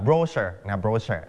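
"brochure" as BRO-sher, ending reduced; British stress on first syllable. Shown as a demonstration. Teacher recaps the British reading, "brochure" stressed on BRO. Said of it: "เพราะคนอังกฤษจะไม่อ่านชั".

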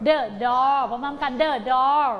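The door said very slowly; slow choral model. Shown as a demonstration. Teacher leading class in The door. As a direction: drag The door out for kids to follow.

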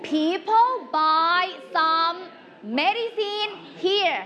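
very slow, word-by-word dictation; slow clue reading. Shown as a demonstration. Teacher repeats the quiz clue to the class, very slowly. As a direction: spell out the clue slowly, pausing between phrases.

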